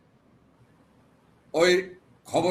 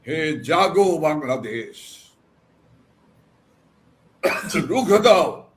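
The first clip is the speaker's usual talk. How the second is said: imitated rallying cry. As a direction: voice a crowd's slogan, building to a short call. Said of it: "চিৎকার করে বলছে". (স্লোগানের মতো কিছুটা উঁচু গলায় ডাক)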